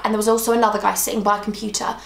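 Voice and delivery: ordinary delivery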